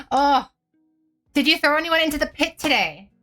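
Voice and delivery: excited. Real, not acted. Teasing viewer about game.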